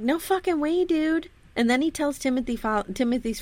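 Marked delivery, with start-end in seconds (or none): 0.0-1.3 higher, casual mock refusal; mock preacher. Preacher refusing the reverend, casual high mock. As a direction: toss it off like a surfer. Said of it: "The preacher's like".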